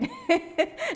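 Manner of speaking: high burst of laughter; amused, high-pitched laugh. Real, not acted. Amused at her own admission.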